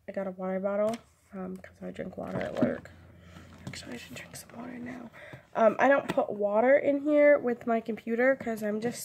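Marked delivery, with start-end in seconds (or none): 3.7-5.1 fast, soft unvoiced whisper; whispered aside. Real, not acted. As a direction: mutter it to yourself quickly. Quick aside to herself.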